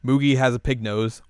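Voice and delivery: ordinary talk, nothing marked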